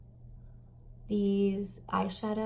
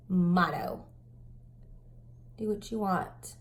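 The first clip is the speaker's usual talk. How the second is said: ordinary talk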